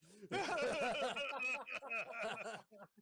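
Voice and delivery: high, loud burst of laughter; helpless high-pitched laughing; long laugh. Real, not acted. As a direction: crack up at the joke. Player cracks up at the GM's act.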